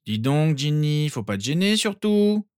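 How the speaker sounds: grumbling friend. A narrator's reading. Friend catches Ginny in the bed, grumbling. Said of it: "grogna la voix de son ami".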